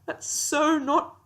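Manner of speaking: slow words through laughter; laughing. Real, not acted. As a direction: laugh while you say it. Laughing at the scene.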